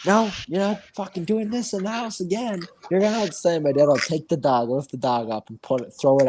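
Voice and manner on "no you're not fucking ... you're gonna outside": higher-pitched fed-up dad; scolding dad. Retold or imitated. Dad banishing the dog from the house, scolding. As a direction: imitate a dad laying down the law.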